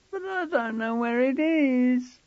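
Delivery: high, slow whine; whining boy. A narrator's reading. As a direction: drag it out in a whine. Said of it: "George whined".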